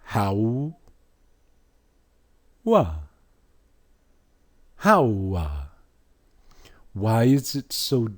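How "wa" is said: Wa called out higher and louder; demo sound, louder. Shown as a demonstration. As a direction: hit the Wa high and loud. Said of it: "look at the phonetics".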